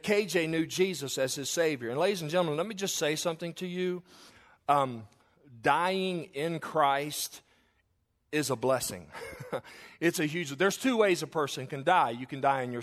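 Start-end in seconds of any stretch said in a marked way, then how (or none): 5.6-7.4 very slow, each word stretched; drawn out slowly. Acted. Preacher to the mourners about dying in Christ, very slow. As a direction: lean on every word, slowly. Preacher stresses key phrase.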